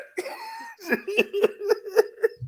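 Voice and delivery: laughing hard; high squealing laugh. Real, not acted. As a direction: burst out laughing. Big laugh.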